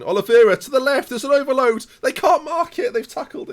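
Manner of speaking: high, loud and building as the attack opens; rising real excitement; excited shouting. Real, not acted.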